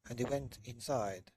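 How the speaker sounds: ordinary delivery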